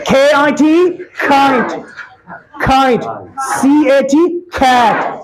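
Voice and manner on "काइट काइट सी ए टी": काइट with a strong puff of air on the k; aspirated k demo. Shown as a demonstration. Teacher showing students the aspirated k in काइट. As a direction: push air hard on the k of काइट. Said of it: "जब ये pronounce करोगे".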